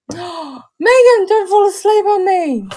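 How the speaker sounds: gasp, then a high, loud teasing cry; mock alarm. To the yawning co-host Megan, mock alarmed and loud. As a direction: pretend panic at her yawning.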